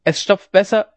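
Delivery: loud, high-pitched outburst; angry threat. A narrator's reading. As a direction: hurl a threat back at him. Furious dike master threatens the worker.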